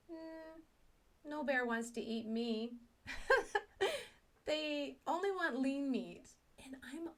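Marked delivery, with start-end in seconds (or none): 3.0-4.1 quick rising giggle. Real, not acted. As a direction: laugh at your own joke. Laughing at her own joke.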